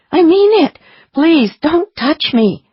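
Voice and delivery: high, loud, slow pleading; frightened plea. A narrator's reading. She backs away in alarm.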